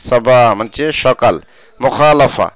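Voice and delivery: usual speaking voice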